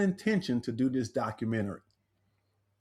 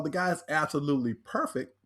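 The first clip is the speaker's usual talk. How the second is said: usual speaking voice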